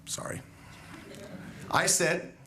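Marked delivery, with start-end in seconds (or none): 0.0-0.4 a quick unvoiced sorry under the breath; whispered aside. Real, not acted. Aside mid-story, dropped to a whisper.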